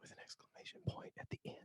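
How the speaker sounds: barely audible voiceless whisper; very soft mock ASMR whisper. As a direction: echo it in a tiny whisper. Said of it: "listen to us talk like this".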